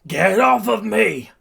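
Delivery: high, drawn-out furious yell; scream. A narrator's reading. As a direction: scream it in outrage.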